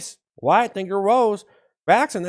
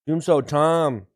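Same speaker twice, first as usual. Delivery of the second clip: ordinary talk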